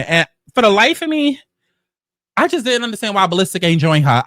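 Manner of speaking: ordinary delivery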